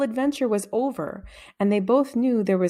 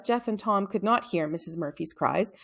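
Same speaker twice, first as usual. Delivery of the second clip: ordinary delivery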